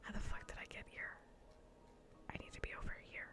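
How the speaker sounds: usual speaking voice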